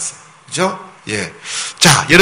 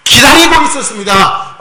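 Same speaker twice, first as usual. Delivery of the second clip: jubilant shouting; 9 semitones higher than usual. Pretend. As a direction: shout for joy like disciples who believed. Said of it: "막 박수치고 막 소리를 지르고".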